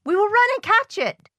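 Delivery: high, loud and urgent; rally a friend to give chase; excited high-pitched call. A narrator's reading. Frog urges a chase after list.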